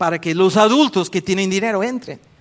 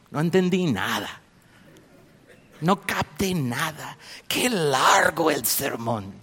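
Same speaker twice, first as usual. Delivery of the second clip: slow, mock bored churchgoer; bored listener. Preacher mimics a listener without the Spirit. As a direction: drawl it like someone unmoved. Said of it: "tú dices".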